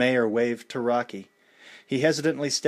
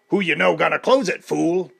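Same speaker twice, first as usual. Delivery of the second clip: loud, menacing challenger; a hint of a brash fighter calling out the champ; Clubber Lang; 6 semitones higher, 7 dB louder than usual. A narrator's reading. Clubber challenging Rocky at the dedication, aggressive.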